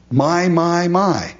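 "My, my, my" said high and ringing; emphatic my. Shown as a demonstration. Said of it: "David makes that emphatic".

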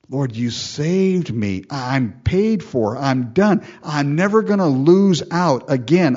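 a believer's loud thanks building up; grateful declaration. Pretend. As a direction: proclaim it to the Lord with growing joy.

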